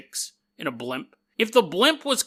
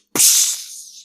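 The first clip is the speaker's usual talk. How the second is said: loud mouth-made smash noise; imitated crash; 5 dB louder than usual. Airman smashing through the station roof, imitated crash. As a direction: burst out an impact sound.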